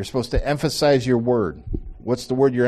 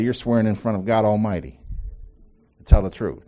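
usual speaking voice

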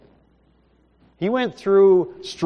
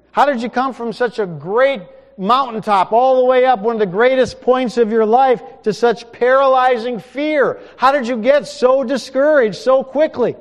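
God's voice, louder and higher, stern; stern rebuke; 9 semitones higher, 8 dB louder than usual. Acted. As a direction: rebuke him for running away. God rebukes Elijah, preacher voicing.

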